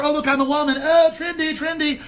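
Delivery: high, prissy mock voice; mock trendy. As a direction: coo like a fussy trendy type.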